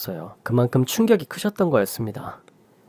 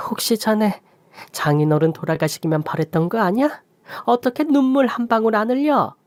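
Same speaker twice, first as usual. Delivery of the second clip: much higher, rebuking; indignant mother; 7 semitones higher than usual. Acted. Mother rebuking her son-in-law at the funeral, high and indignant. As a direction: scold him for his coldness.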